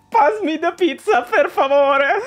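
high, loud hammed-up Italian begging; lightly voiced comic Italian plea; mock Italian plea. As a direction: beg like a cartoon Italian.

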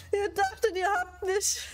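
high cackling taunt; gloating laugh. Real, not acted. Gloating after escaping chasers.

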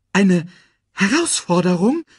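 high, loud, alarmed question; worried. A narrator's reading. Timon queries Nala about the challenge, worried. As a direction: echo the news with rising alarm. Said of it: "fragte er dann mit besorgter Stimme".

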